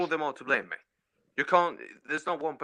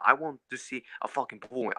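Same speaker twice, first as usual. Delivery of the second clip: normal talking voice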